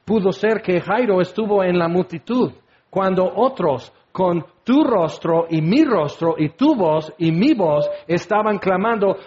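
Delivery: ordinary delivery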